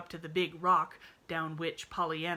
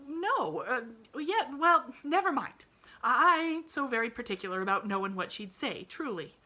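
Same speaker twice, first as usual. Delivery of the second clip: stammered; 8 semitones higher, 8 dB louder than usual. A narrator's reading. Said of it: "stammered Nancy".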